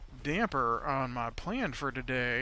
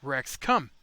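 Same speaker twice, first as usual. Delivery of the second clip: louder call, like to a stubborn dog; yelled command; 2 semitones lower, 7 dB louder than usual. Shown as a demonstration. Player yells at his untrained dino to come, loud. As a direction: yell it so he hears. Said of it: "so you gotta yell a lot".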